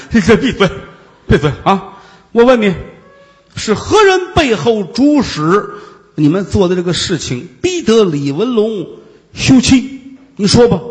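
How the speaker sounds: loud, commanding demand for answers; stern interrogation. Acted. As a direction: bark the questions at her. Monk stands and interrogates harshly.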